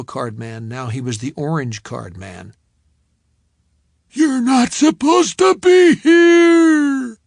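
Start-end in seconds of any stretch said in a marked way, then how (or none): 4.1-7.2 high, slow furious shout; angry shout. A narrator's reading. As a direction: scream him off the property. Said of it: "he had said".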